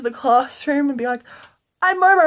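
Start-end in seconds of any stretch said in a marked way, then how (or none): 1.8-2.3 Momo's voice. Acted. Momo introducing himself proudly.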